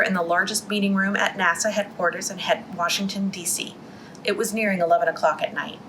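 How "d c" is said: plain reading voice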